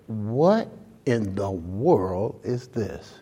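soft, slow disbelief; bewildered, hushed. Retold or imitated. Pastor facing an empty auditorium, hushed and slow. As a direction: ask it quietly, half stunned.

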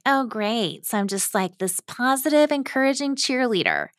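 retold sarcastic dismay, pitch climbing; eye-rolling sarcasm. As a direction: say it with a giant eye roll. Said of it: "responded to her with a giant eye roll".

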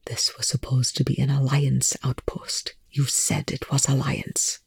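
hushed, hollow half-whisper; soft, hollow voice. A narrator's reading. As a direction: whisper it, emptied out. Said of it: "his voice hollow".

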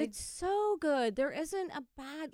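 slow rapturous praise; gushing. Real, not acted. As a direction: stretch it out, really selling how good it is. Gushing about the show's music.

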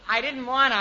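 ordinary delivery

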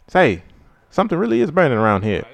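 quick, brisk cartoon punchline. Friend finishing the Tom and Jerry bit, fast cartoon delivery. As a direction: snap into the joke line fast. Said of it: "that's from Tom and Jerry".

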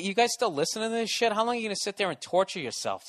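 normal talking voice

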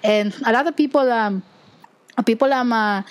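Filipino-accented English, demonstrated casually; Filipino accent demo. Shown as a demonstration. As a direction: show how Filipinos speak English, accent and all. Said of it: "this is how we talk".

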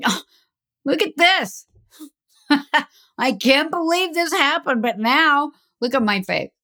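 high, amused, laughing through it; chuckling at his own battered face; laughing at himself. Retold or imitated. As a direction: laugh off the bad luck. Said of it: "Or you can say".

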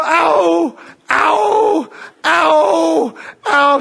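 high, loud mock pain cries of ow. Girl with the broken leg walks without crutches, mock pain cries. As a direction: imitate a girl yelping in pain on each step. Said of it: "And she go".

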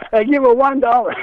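high mock offer amid laughter; imitated haggling offer. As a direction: repeat the punchline, pitched high. Repeats the punchline amid his laughter.

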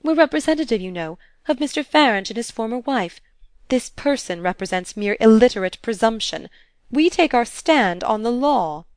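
plain reading voice

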